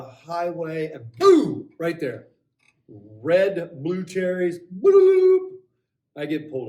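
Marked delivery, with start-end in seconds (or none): 1.2-1.7 loud, high-pitched "boom"; mock boom. Storyteller springs the police stop on listeners, explosive boom. 4.8-5.5 loud, high siren whoop; imitated police siren. Driver recalls being pulled over, whooping siren noise.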